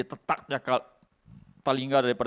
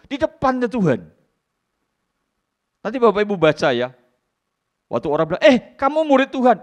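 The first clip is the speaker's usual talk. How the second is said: preaching at full force; 9 semitones higher, 8 dB louder than usual. Acted. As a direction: stir the congregation. Preaching with acted quarrel. (khotbah keras dan bersemangat)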